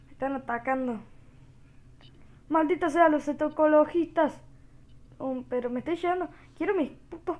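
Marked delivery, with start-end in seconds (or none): 2.5-4.4 loud, higher-pitched gamer outburst; exasperated cursing. Pretend. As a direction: curse the attackers in playful outrage. Cursing enemies attacking him.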